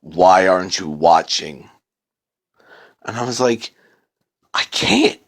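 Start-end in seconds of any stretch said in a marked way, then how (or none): 0.0-1.7 low, dark menacing voice; a looming dark voice from the screen; creepy low demand. A narrator's reading. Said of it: "a really dark voice say". 4.5-5.2 breathy, unvoiced gasp of fear; scared whisper; frightened. A narrator's reading. Terrified narrator answers the voice.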